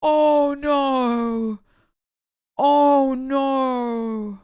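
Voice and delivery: high, loud panicked oh no; oh no shout. Real, not acted. Streamer to chat after mistake, loud panicked shout. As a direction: yell it in real dismay.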